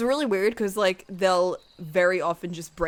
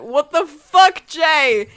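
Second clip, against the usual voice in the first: loud, slow, higher indignant shout; mock outrage; 6 semitones higher, 10 dB louder, much slower than usual. Reacting to Jay's nightmare lesson, mock outraged shout. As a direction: lean hard on each word in acted outrage.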